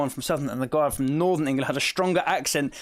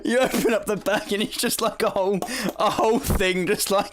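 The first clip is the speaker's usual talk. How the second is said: breaking up with giggles; real laugh interrupting the explanation; laughing while talking; 4 semitones higher than usual. Real, not acted. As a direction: push the words out through laughter. Tries explaining while still laughing.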